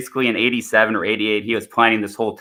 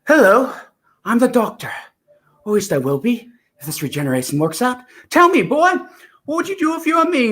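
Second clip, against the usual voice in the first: much higher, breathless eccentric Doctor; Doctor Who impression; 11 semitones higher than usual. Acting out Doctor lines for a friend, high and breathless. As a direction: play the excitable new Doctor, high and breathless. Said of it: "he's got that breathless energy".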